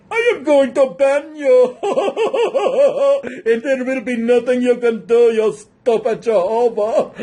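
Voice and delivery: loud gloating villain voice with a laugh. Pretend. Playing the official who bans the Witnesses, loud and gloating. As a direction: boom out the threat like a cackling tyrant. Voicing the banning authority, gloating.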